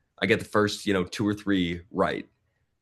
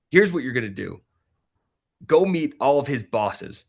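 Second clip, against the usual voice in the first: imitating wife laying down orders, higher-pitched; retold take-charge voice, pitched up; assertive wife; 6 semitones higher, 4 dB louder than usual. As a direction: give firm marching orders as the fiery wife. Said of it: "fiery and assertive".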